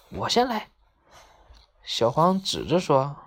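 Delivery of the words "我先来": high-pitched, eager claim; eager call. A narrator's reading. Maomao volunteering for the first turn, eager call. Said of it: "毛毛喊".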